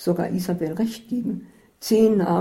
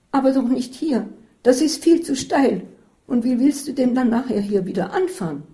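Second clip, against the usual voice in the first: higher, louder fretful objection; anxious protest; 6 semitones higher, 4 dB louder than usual. A narrator's reading. Isabel refusing to stop on the steep road, higher and fearful. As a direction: object in a worried rush.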